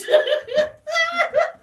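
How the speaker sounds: high, teary shriek of laughter; a squealing, sobbing laugh fit; high cackling laugh-cry. Real, not acted. Helpless laughing fit on camera.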